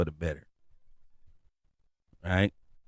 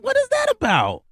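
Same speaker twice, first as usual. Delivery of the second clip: much higher, squeaky suspicious question; suspicious surprise. Retold or imitated. Suspicious of a moment of joy, high squeak. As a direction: squeak it out in disbelief. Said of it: "It's like".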